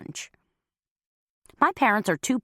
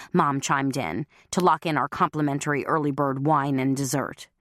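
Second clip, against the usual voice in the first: plain reading voice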